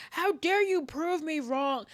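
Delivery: slow, high-pitched indignation; hammed-up offended protest; mock outrage. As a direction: act affronted, drawing it out high. Mock outrage at being corrected.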